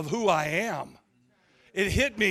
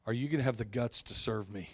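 low, soft solemn voice; voice of God; 8 semitones lower, 5 dB quieter than usual. Retold or imitated. God calling out the preacher in church, low solemn voice. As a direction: let it sink in quietly and gravely. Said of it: "He said".

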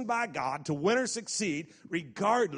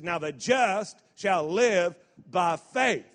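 slow, loud and weighty; slow emphatic recital; 5 dB louder, slower than usual. Shown as a demonstration. Scripture recital.

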